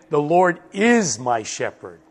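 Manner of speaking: is lifted and held above the rest; stress on is. Shown as a demonstration. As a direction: punch the word is, insisting on it. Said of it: "Not was or will be, is".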